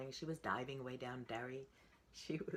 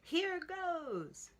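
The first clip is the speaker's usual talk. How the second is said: much higher, louder bold yell; 7 dB louder than usual. Acted. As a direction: shout it before jumping in. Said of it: "He held his nose and yelled".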